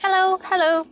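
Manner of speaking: high, bright little double hello; cheery greeting. Shown as a demonstration. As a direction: chirp a high hello twice. Greeting viewers cheerfully after topic.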